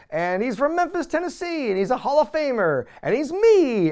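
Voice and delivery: high, hammed-up announcer build; mock ring announcement. Lawler announcing himself as the opponent, mock grandiose. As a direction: build to a proud reveal, imitating an announcer.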